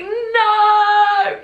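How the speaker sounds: high, loud drawn-out "No!"; mortified shout. Retold or imitated. Brother teasing about dad seeing, loud high no. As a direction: wail it in horror.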